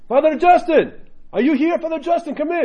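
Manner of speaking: very high, loud imitation of an old monk hollering; monk calling out. Monk hailing Father Justin at his apartment, high holler. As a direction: call excitedly from the next room, high-pitched.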